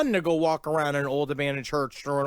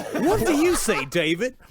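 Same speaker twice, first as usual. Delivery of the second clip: higher, teasing radio host; George Noory; 5 semitones higher than usual. Acted. Host quizzing his guest on caramel, hammed-up eagerness. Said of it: "a perfect George Noory impression".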